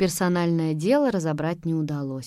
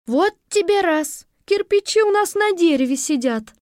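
amazed teacher; 10 semitones higher, 5 dB louder than usual. A narrator's reading. Teacher seeing the bricks up a tree, astonished. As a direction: exclaim in amazement.

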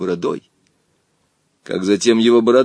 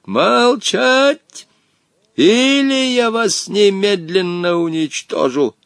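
furious roar; 8 semitones higher, slower than usual. Acted. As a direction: roar the boys into silence. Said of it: "заорал".

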